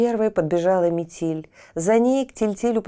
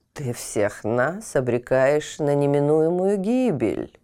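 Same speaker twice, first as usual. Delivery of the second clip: ordinary delivery, nothing marked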